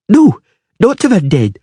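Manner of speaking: cling to the Dryad's side; eager girl. A narrator's reading.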